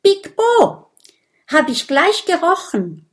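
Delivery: high, loud triumphant cry; gleeful exclamation; joyful call. A narrator's reading. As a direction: crow with delight at being right. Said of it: "ruft Minimo freudig".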